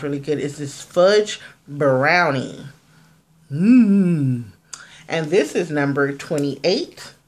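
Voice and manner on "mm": breathy mm. Real, not acted. Admiring the fudge brownie carton.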